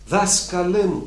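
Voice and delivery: earnest prayer; pleading prayer. Pretend. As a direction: call to the Master slowly. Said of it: "πες του".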